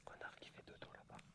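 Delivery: soft unvoiced whisper; whispering over a crib. Real, not acted. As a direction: whisper so it stays asleep. Whispering near sleeping animal.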